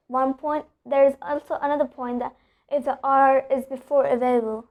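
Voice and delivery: usual speaking voice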